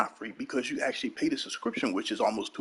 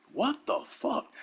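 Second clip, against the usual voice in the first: high disbelief; 11 semitones higher, slower than usual. Real, not acted.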